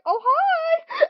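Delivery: imitating someone discovered in a closet; hammed-up caught-out greeting. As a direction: blurt a high, loud hello as if caught hiding. Retold caught hiding in the closet.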